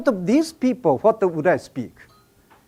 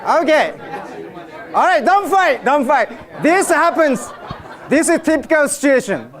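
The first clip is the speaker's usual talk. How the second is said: high, raised voice over the audience; raised-pitch crowd wrangling; calling out; 8 semitones higher than usual. Real, not acted. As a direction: call a squabbling crowd to order. Joking call to squabbling audience.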